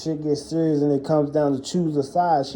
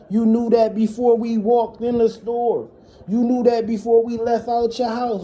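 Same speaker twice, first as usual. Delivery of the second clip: higher, heated retold rant; retold cussing out; 8 semitones higher than usual. Son cussing his mom out on the way home, heated and high. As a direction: lay into her, pitched up and heated. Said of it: "cussing her the fuck out and hollering at her".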